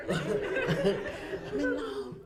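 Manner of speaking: amazed giggling question; laughing disbelief. Pretend. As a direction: gasp it out, laughing. Astonished reaction, laughing.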